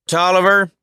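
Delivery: loud, high call across the yard; yelling. A narrator's reading. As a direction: shout the name out. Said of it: "she yells".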